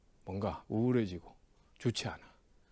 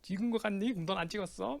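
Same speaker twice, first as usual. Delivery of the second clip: high-pitched mock retort; teasing comeback; 7 semitones higher than usual. Accused of lucky guessing, firing back teasingly. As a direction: throw the jab back at a pal. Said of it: "내가 걔를 또 갈궜지".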